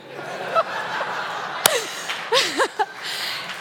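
long rising laugh; bubbling laugh rising up; high laughter. Real, not acted. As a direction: laugh freely at the joke on yourself. Laughing at her own story.

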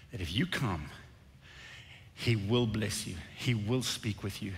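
low, soft sincere promise; earnest reassurance. Real, not acted. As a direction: drop the voice and reassure them. Preacher earnestly promises God's blessing.

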